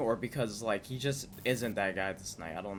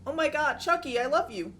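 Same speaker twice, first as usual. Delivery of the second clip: put-on high, loud adoring kid voice; 4 dB louder than usual. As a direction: gush over Chucky like a tiny fan. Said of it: "kid at the restaurant".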